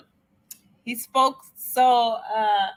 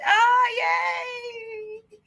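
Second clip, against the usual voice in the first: very high, long-held squeal; giddy high-pitched "yay"; delighted cheer; 13 semitones higher than usual. Real, not acted. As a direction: squeal with glee at a compliment. Delighted by compliment on her French.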